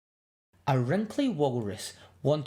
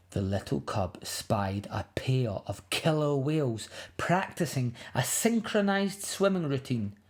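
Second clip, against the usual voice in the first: ordinary delivery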